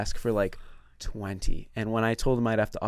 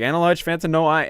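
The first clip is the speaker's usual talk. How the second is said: higher, louder mock recital; scripted order; 6 semitones higher, 6 dB louder than usual. Regular ordering as he drives away, mock scripted. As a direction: toss out the memorized tail of an order. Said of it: "read it off like it's a script".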